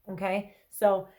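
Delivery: ordinary delivery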